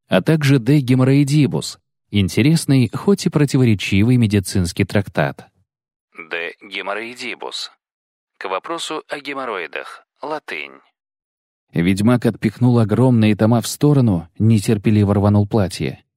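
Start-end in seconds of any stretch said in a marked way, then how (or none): 6.3-10.8 hushed, much softer note; footnote aside. A narrator's reading. Narrator explains a treatise's Latin title, soft footnote aside. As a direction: drop the volume for a translator's note.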